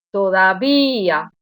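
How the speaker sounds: slow "todavía", í and a in two syllables; hiatus pronunciation. Shown as a demonstration. Teacher showing students the vowel contrast, "todavía" as a stretched hiatus. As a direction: say "todavía" with a long stressed í, separate from the a. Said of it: "notad cómo las pronuncio de manera diferente".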